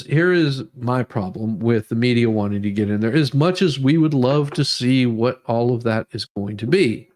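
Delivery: ordinary delivery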